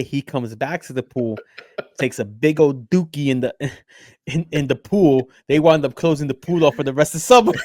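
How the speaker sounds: ordinary talk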